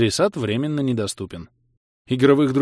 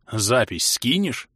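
slow, eager, coveting question; greedy; much slower than usual. A narrator's reading. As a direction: ask slowly with greedy interest. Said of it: "жадно спросил Влас". (жадно, с протяжным интересом)

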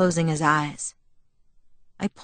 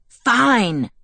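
higher, exasperated mild growl; 5 semitones higher, 4 dB louder than usual. A narrator's reading. Bella giving in to Jacob's ride, growling. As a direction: give in angrily on one word. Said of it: "I growled".

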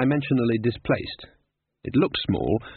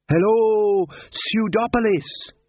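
shouting to crowd; 10 semitones higher, much slower than usual. Acted. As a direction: call out to a stadium. Rock star greets crowd.

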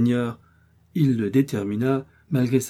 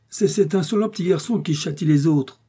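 raised, high defiant cry; D'Artagnan cries out; 7 semitones higher, 4 dB louder than usual. A narrator's reading. D'Artagnan bursting in to challenge the stranger, defiant cry. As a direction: fling the challenge from the doorway. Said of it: "s'écria-t-il".

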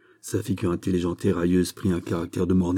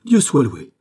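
higher, louder cry of joy; relieved exclamation; 5 semitones higher, 6 dB louder than usual. A narrator's reading. Aramis claps his hands at the news, exclaiming. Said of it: "s'écria Aramis en battant des mains".

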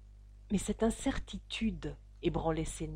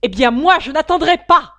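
very loud, higher furious roar; roaring in anger; 9 semitones higher, 20 dB louder than usual. A narrator's reading. Father roars back at the threatening suitors. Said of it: "rugit Ferrier en colère".

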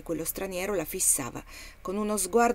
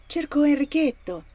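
anxious call; 8 semitones higher, 8 dB louder than usual. Acted. Mother calls looking for her son.

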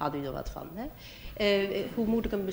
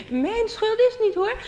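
much higher, slow, put-upon protest; whiny angel; 14 semitones higher, 7 dB louder, slower than usual. Pretend. Second church angel denying blame, high and slow. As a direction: squeak it like a fussy angel. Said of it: "een andere witte engel en die zei".

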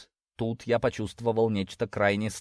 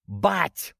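short, raised-pitch protest; exclaiming; 6 semitones higher than usual. A narrator's reading. Daughter cutting off her father, exclaiming. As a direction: cut him off with an exclamation. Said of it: "воскликнула Монро".

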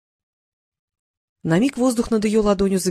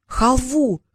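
higher, eager cry; eager exclamation; 5 semitones higher than usual. A narrator's reading. Boy asked what he wishes for, exclaiming. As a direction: exclaim it like a keen child. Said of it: "воскликнул Халанбери".

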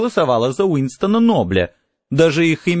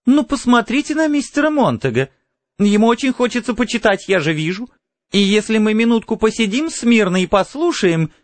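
high, chattering lady's voice; lively high-voiced persuading; coaxing society lady; 9 semitones higher than usual. A narrator's reading. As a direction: talk the others round, pitched well up. Mrs Phelps coaxes the others.

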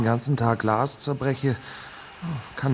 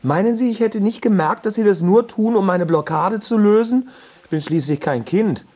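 indignant outburst; 5 semitones higher, 5 dB louder than usual. Acted. Man accuses her of manipulating him.

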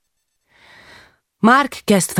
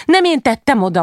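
high, indignant denial; narrated kid talking back, higher pitched; child's protest; 9 semitones higher, 3 dB louder than usual. A narrator's reading. Child protests the mother's accusation.

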